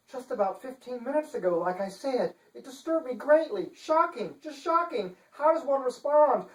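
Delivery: high, prim and indignant; a dainty lady playing at outrage; fussy chancellor. Chancellor protesting to the detective, high and prim.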